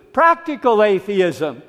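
normal talking voice

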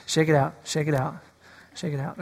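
fading calm. Shown as a demonstration. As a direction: let it trail away quietly. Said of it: "Just everybody relax".

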